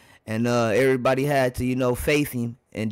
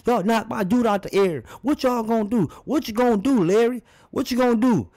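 high-pitched, worked-up mock confrontation; imitating irate player; 9 semitones higher than usual. YouTuber acts out what Kareem told Larry, mock confrontation. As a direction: call out a rival as a fed-up ballplayer. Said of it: "I kinda feel like he said".

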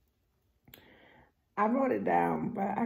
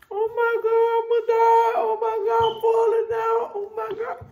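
much higher, louder panicked shrieking; mock terrified scream; 8 dB louder, faster than usual. Son on the scream machine, hammed-up terrified yelling. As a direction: scream like the ride will kill you. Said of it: "He was screaming".

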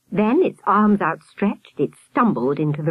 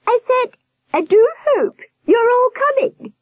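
insistent warble; 5 dB louder than usual. A narrator's reading. Nurse repeating herself to blank-faced children, insistent high warble. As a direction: repeat it pointedly in a high-pitched, louder warble. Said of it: "she repeated".

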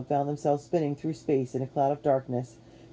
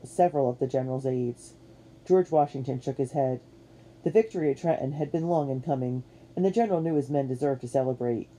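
usual speaking voice

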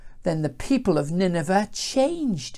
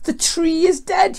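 very high moan; acted storybook whinge; groaned lament; 13 semitones higher, 4 dB louder than usual. A narrator's reading. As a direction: wail it in self-pity. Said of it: "he groaned".

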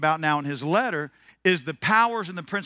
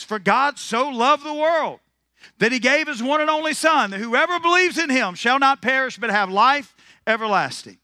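scripture recitation; 6 semitones higher, 5 dB louder than usual. Acted. Quoting scripture with emphasis.

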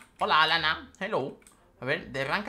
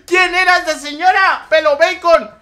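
high, loud shrieking at the screen; shrill gamer panic, full volume; panicked yell; 13 semitones higher, 15 dB louder than usual. Real, not acted. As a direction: scream at the woman who got in the way. Enemy ambush in game.

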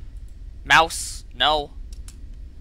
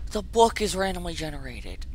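softer, resigned repeat; subdued disbelief; 8 dB quieter than usual. Real, not acted. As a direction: let it drop away quietly. Repeats it, letting it sink in.

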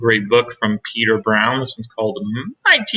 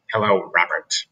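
stern teacher voice; 7 semitones lower than usual. A narrator's reading.

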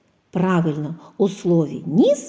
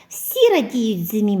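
normal talking voice

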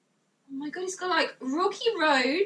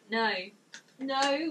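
ordinary talk

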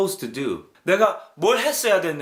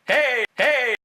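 put-on voice; 8 semitones higher than usual. Funny put-on voice.

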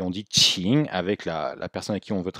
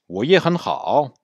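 我也很好 run together, middle syllables level and high; natural-speed take, only last 好 dips; faster connected speech; 5 semitones higher, 4 dB louder, slower than usual. Shown as a demonstration. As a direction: join 我也很好 into one phrase. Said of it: "Si on parle vite".

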